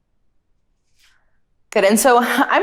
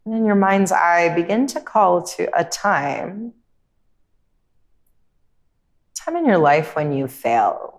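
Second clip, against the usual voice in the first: calm, unhurried meditation voice with long pauses; guided meditation; 3 dB quieter than usual. Real, not acted. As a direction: guide them gently inward, leaving space between phrases.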